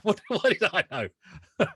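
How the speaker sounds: slow, laughing it out. Real, not acted. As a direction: chuckle at how clueless he was. Laughing at own ignorance.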